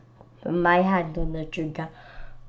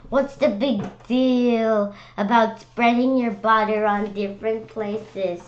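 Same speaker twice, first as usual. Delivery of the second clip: slow, louder, incredulous asking; indignant question; 4 semitones higher, 6 dB louder, slower than usual. Real, not acted. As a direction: demand why it matters, drawn out. Child questioning indignantly mid-story.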